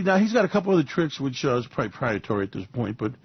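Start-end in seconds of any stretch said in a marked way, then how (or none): none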